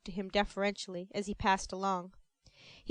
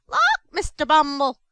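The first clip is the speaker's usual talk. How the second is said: louder, higher exclamation; startled cry; 5 semitones higher, 10 dB louder than usual. Acted. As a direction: gasp it out in shock. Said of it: "cried Missus Mann, starting back".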